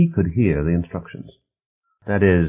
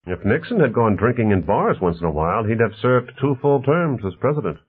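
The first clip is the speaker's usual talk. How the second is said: lightly voiced host, higher in pitch; lively, mildly voiced character dialogue; affable host Bonner; 8 semitones higher, 4 dB louder than usual. Bonner talks politics with his guests, lightly voiced affable host.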